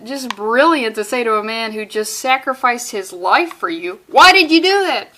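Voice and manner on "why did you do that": loud exasperated why; mock exasperated yell. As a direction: yell it at the fool, half joking. Aquaman yelling at dying friend.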